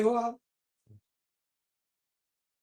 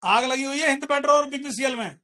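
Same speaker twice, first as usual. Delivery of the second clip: excited shout; 3 semitones higher, 3 dB louder than usual. Real, not acted. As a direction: announce the rally with glee. Excited calling out rally.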